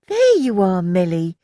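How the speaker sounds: high, loud happy call; exclaiming. A narrator's reading. Annie greeting Milly on arrival, exclaiming loudly. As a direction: call out brightly to a friend. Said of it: "Annie exclaimed".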